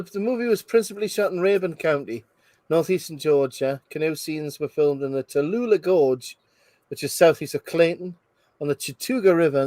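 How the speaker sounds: ordinary talk, nothing marked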